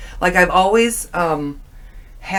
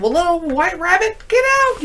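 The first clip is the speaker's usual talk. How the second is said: high-pitched shoo; 11 semitones higher than usual. Retold or imitated. Shooing rabbit from dream.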